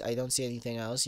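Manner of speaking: usual speaking voice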